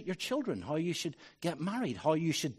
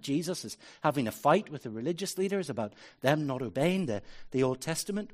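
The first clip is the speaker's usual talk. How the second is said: normal talking voice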